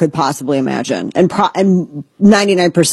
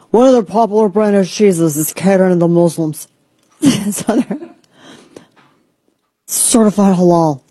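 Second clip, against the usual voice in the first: half-joking fake voice for a news quote; odd put-on voice. Reading out an outraged post in a lightly put-on voice. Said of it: "I don't know why I'm talking like this".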